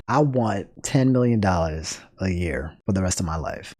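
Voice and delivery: low, soft movie-villain line; lightly voiced movie line, dropped low; Batman movie quote. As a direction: growl it like a film quote, low. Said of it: "it's from Batman".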